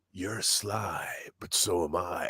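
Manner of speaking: low, soft, whispered menace of a quote; hushed film quote. Retold or imitated. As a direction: whisper it slowly, like quoting a thriller. Repeats the film line he quoted.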